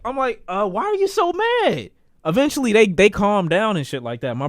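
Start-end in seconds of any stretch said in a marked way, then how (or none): 0.5-1.9 confused. Retold or imitated. Confused at angry sisters.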